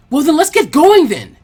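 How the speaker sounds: loud, high excited shout; excited yell. Acted. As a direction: yell it out, bursting to go. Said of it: "Naruto yelled".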